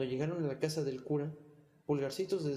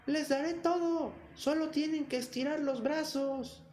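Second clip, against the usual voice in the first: full-lung shout; 12 semitones higher, slower than usual. A narrator's reading. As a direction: shout at the top of your lungs. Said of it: "se puso a gritar por tercera vez con todas sus fuerzas".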